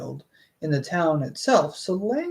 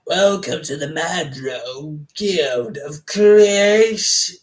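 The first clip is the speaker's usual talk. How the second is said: slow announcer-like welcome, swelling on Creation; mock grand welcome; 4 dB louder, slower than usual. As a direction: stretch out CREATION with a showy flourish.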